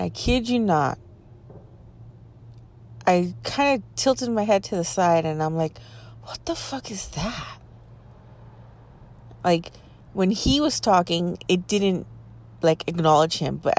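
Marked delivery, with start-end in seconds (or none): 6.2-7.6 a hushed, stunned question, much softer; hushed disbelief. Retold or imitated. Spotting the creature on the mountain, hushed shock. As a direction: breathe it out in shock.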